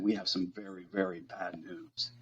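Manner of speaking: quiet, somber doctor delivering hard news; grave news. Retold or imitated.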